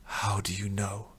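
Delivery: slow, breathless whisper; a broken man whispering his doubt to the old man; very quietly. Acted. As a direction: ask it softly, almost afraid to hope. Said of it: "very quietly".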